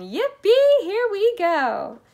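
very high whoop, stretched out slowly; loud, gleeful yell; joyful shout. A narrator's reading. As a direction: whoop as if riding a rocket. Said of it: "shouted Grandpa Joe again".

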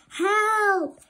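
high, loud cry for help; shouting for help. A narrator's reading. Trapped sister calling for help, loud shout. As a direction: scream for help. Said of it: "she shouted".

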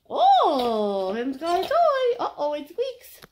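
sing-song puppy voice. Real, not acted. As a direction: coo excitedly to a dog with a new toy. Baby talk to dog with toy.

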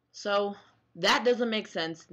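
ordinary delivery

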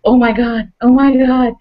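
a hammed-up scared cry; mock panic. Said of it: "she's like".

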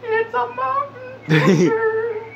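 squeezed out through helpless laughter; words barely surviving a laughing fit. Real, not acted. Gamer laughing at a funny in-game picture, words cracking.

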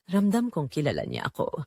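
ordinary delivery

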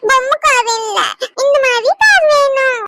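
put-on a small boy insisting on a real car; whining child. (அடம் பிடிக்கும் குழந்தை)

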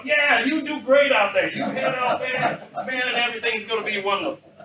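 loud, high impression of an upbeat general; hammed-up high-pitched cheerleading from the boss. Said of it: "He had this kind of a high-pitched voice".

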